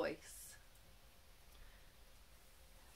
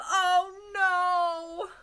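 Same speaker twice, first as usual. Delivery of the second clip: much higher, much louder shriek of dismay; panicked cry; 11 semitones higher, 10 dB louder than usual. A narrator's reading. Mom shrieks as the vacuum wrecks the house, high and loud. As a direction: wail it in horror.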